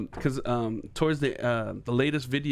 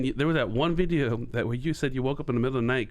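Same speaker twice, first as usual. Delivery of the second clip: normal talking voice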